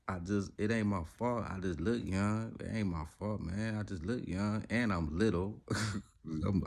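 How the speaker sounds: ordinary talk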